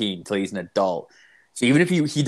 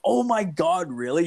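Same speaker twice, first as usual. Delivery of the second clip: high-pitched "oh my God" reaction; retold surprise; 7 semitones higher than usual. Telling a friend how he reacted to buddy's news, retold surprise. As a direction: replay your shocked response, pitched up. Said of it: "I'm like".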